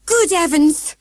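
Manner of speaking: high, loud shriek. A narrator's reading. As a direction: shriek in alarm. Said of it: "shrieked Grandma".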